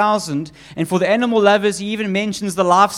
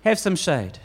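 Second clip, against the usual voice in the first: softer, kind and easy; gentle offer; 3 semitones lower, 4 dB quieter than usual. Acted. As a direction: offer shade to the sulking prophet tenderly. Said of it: "What does God do?".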